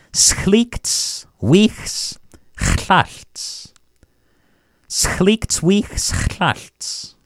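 very slow, careful double repeat; slow repeat for learners. Shown as a demonstration. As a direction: model it slowly twice. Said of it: "There's something you could take home today".

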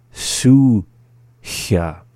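"Suchia" split, long "Su", pause, then "chia"; slowly. Shown as a demonstration. Teacher giving word examples, "Suchia" said slowly in syllables. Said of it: "the second time slowly".

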